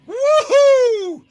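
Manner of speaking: loud, very high whoop of victory; wild high woohoo. Real, not acted. Fan cheering the winning goal, high loud whoop. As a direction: whoop at the top of your voice.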